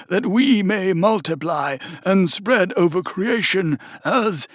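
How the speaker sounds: put-on an old zealot intoning a holy war; fanatical cultist. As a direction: cultist invoking the fiend against rivals, fanatical.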